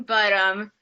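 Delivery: normal talking voice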